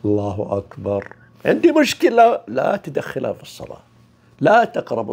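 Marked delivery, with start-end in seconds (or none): 1.5-2.4 higher, louder anxious cry; worried worshipper. Retold or imitated. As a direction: burst out with a worried complaint. (صوت قلق مرتفع)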